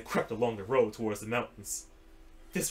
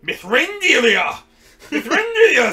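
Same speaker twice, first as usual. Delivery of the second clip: high, loud joyful shout; excited cry; 13 semitones higher, 13 dB louder than usual. Acted. Legolas hailing the wizard, high loud cry. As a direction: shout the name in joy. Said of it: "said Legolas".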